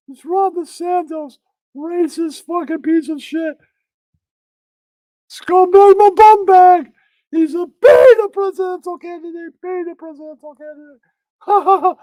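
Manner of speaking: high, loud cackling cartoon mirror; talking mirror. The mirror cackling at Robert Kennedy Junior in a high voice. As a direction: taunt like a mocking magic mirror. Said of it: "Then the mirror goes".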